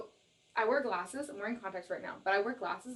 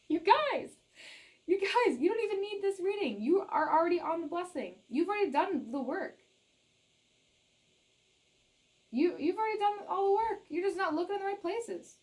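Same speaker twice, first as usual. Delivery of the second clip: higher, louder excited urging; 8 semitones higher, 3 dB louder than usual. Real, not acted. Reader excitedly telling viewers they already have it. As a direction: urge them on, much higher and louder. Excited scolding after laugh.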